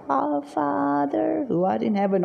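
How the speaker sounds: imitated droning devotion; mock pious prayer. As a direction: intone it like a showy public prayer. Mimics showy prayer, hands raised.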